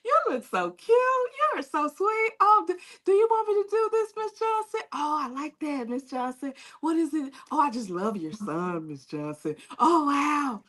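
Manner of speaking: high, sugary fake flattery; sugary suck-up. Woman mocks a flattering girlfriend, high and sugary. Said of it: "You have those that".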